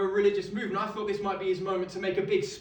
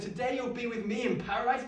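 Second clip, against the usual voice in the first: higher, mock-disbelieving echo of the words; incredulous repeat; 7 semitones higher than usual. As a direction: repeat it as if it's absurd. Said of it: "What a ridiculous thing to say".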